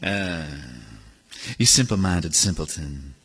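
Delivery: deep scornful put-down; sneering snob. Retold or imitated. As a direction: talk down to someone as a fool. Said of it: "They just kinda".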